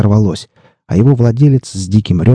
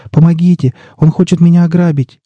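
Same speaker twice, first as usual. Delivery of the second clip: put-on high-pitched girl begging for help; high, imploring cry without shouting; pleading; 9 semitones higher than usual. A narrator's reading. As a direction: plead urgently in a raised, girlish voice. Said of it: "взмолилась девушка".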